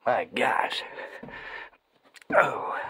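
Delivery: ordinary talk, nothing marked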